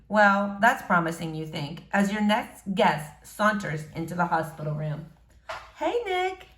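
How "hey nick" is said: put-on a higher, girlish hello; shy girl greeting a boy she likes. April greets Nick in hospital, higher girlish voice.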